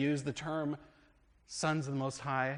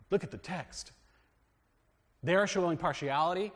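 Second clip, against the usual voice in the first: usual speaking voice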